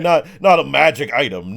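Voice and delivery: normal talking voice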